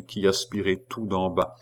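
ordinary delivery, nothing marked

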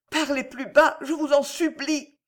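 high, frightened supplication; terrified pleading. A narrator's reading. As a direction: beg him in a high, terrorized voice. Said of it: "balbutiait-elle, terrorisée".

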